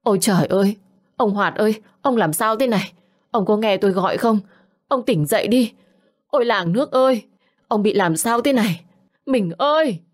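acted wail of a wife in shock; anguished wailing. A narrator's reading. Wife finds her husband lifeless, wailing in anguish. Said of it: "bà Nương kêu gào thảm thiết".

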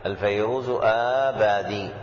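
"الفيروز آبادي" as al-fayruzu aaa-baadii, long vowels held; long madd. Shown as a demonstration. Teacher repeating the corrected name, "آبادي" with long drawn vowels. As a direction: hold the opening aa of "آبادي". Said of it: "بالمد".

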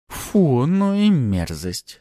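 slow, high drawn-out disgust. A narrator's reading. Artara at the spurting blood, disgusted. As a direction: recoil in exaggerated distaste. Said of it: "скривилась Артара".

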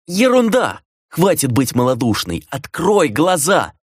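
high, impatient exhortation; scolding her for faint-heartedness; angry dismissal. A narrator's reading. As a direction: burst out high, then stretch the final command. Brother angrily rejects her despair.

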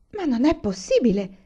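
a touch of startled disbelief; exclaiming. A narrator's reading. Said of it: "esclamò mamma".

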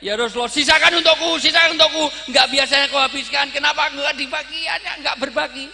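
high, very loud hammed-up protest; screaming wife. Wife begs husband to share the drink, acted screaming. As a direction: yell it out like an upset wife. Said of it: "Ummul Mukminin langsung teriak-teriak".

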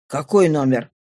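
low voice of a father; questioning father. Acted. Father questions his daughter, low questioning voice. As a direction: drop the pitch for the father's question.

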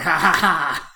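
big fake guffaw; imitated laughter. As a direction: imitate pranksters bursting out laughing. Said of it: "come out and be like".

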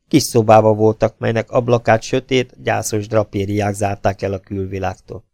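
usual speaking voice

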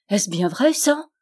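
rising up sharply on ça; wide-eyed question. Acted. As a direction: ask it as an astonished child. Boy asks doubtfully.

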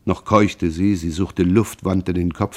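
usual speaking voice

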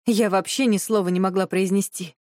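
plain reading voice

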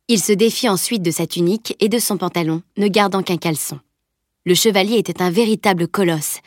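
usual speaking voice

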